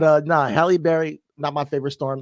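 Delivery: ordinary delivery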